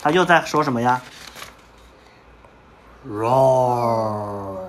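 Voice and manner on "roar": long, low growling roar; tiger roar. Acted. Teacher models the tiger's roar for the class, roaring low. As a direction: roar like a tiger, deep and drawn out.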